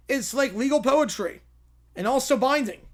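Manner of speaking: usual speaking voice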